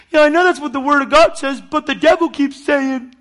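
high, loud mock whining; acted-out complainer. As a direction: exaggerate a believer's helpless complaint. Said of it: "I'm acting it out".

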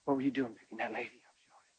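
whispered, fast, discreet question; hushed question. Retold or imitated. Friend quietly asking Sheldon about the lady, whispered.

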